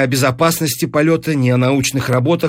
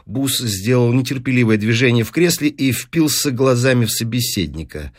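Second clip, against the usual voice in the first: plain reading voice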